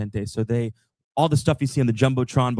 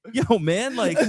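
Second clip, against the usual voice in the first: laughing high pleading; 10 semitones higher, slower than usual. Retold or imitated. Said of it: "And I was like".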